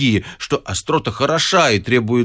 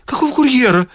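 high, startled question; horrified; 9 semitones higher, 4 dB louder than usual. A narrator's reading. The wit aghast at the courier talk. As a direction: recoil in alarm. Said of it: "ужаснулся Изнурёнков".

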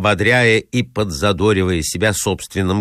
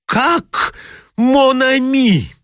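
much higher, slow outraged cry; furious cry; 3 dB louder, much slower than usual. Acted. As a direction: fling the word back in fury. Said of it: "вскричал он в бешенстве". (яростный, высокий протяжный вскрик)

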